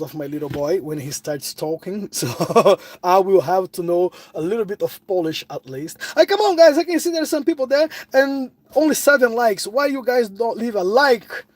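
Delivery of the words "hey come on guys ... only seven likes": ordinary talk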